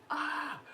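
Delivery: high exasperated yelp; frustrated cry. Retold or imitated. Venting frustration at herself, high exasperated yelp. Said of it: "And it's like".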